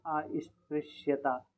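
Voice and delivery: अस्पृश्यता drawn out slowly, syllable by syllable; teacher spelling अस्पृश्यता aloud slowly; slow spelling demo. Shown as a demonstration. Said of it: "तो कैसे लिखा जाता है?".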